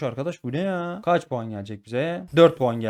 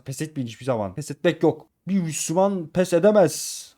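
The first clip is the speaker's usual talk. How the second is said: usual speaking voice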